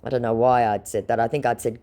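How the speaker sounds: usual speaking voice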